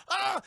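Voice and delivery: seeing her reflection in the sunglasses, startled shriek. Retold or imitated. Tulip sees reflection.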